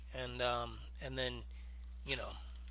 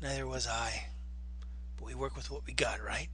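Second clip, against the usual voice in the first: soft low gruff whisper; 4 semitones lower, 12 dB quieter than usual. Retold or imitated. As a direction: hush it like a tired soldier. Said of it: "And he says".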